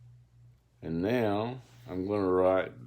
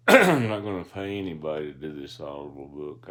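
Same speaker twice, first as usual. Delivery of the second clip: firm declaration; 3 semitones lower, 8 dB louder, faster than usual. Real, not acted. Declares he'll narrate himself.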